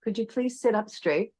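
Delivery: soft, lilting polite request; gentle request. Shown as a demonstration. Yoga teacher showing her class the kinder tone, soft and polite. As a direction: ask softly and kindly, pitched a little up. Gentle take against the tart one.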